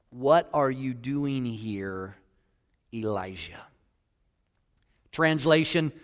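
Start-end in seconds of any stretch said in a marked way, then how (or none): 0.1-3.6 slow, soft, hushed question; quiet voice of God. Acted. Preacher voicing God's question, slow and hushed. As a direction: ask it gently and slowly.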